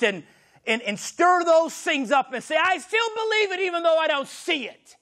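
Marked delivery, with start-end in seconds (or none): 2.6-5.0 declared shout. Acted.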